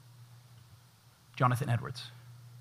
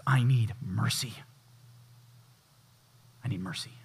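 whispered plea for mercy; 6 semitones lower, 5 dB quieter than usual. Acted. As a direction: breathe the plea, barely audible. Tax collector's humble plea.

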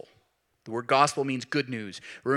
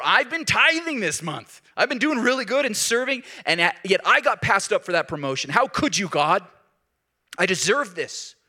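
entitled complaint; 6 semitones higher, 5 dB louder than usual. Retold or imitated. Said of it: "We say".

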